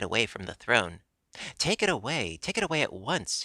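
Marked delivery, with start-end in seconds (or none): 1.6-3.4 the king wants the egg gone now; frantic shout. Acted. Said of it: "he shouted".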